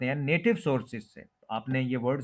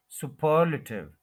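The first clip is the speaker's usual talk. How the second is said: Superlative as su-PER-luh-tiv; superlative with heavy second syllable, quick weak luh; stress on second syllable; slower than usual. Shown as a demonstration. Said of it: "second syllable पे आपने stress".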